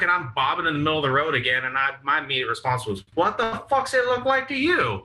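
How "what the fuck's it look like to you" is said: put-on high, incredulous drunken retort; mouthy backtalk. Drunk teen answering the cop's question, mouthy. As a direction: throw it back high and cheeky.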